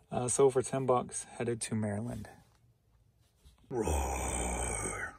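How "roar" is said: low, playful mock roar; dinosaur roar. Showing off a dinosaur toddler hat, low playful roar.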